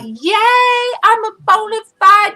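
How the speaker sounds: high-pitched re-enacted celebration; loud excited cheer. Streamer retells celebrating her first troll, loud excited cheer. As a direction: cheer it out like a proud winner. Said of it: "I was so loud".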